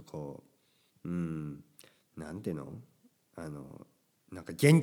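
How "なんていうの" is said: normal talking voice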